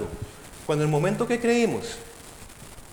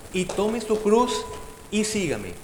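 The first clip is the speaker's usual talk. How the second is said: "y tome su cruz" slowed, with the y leaned on hard; emphatic, deliberate y before each verb; stress on y; 2 semitones higher, slower than usual. Shown as a demonstration. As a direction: drag out each y and give it weight. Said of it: "¿Por qué mi énfasis en la I?".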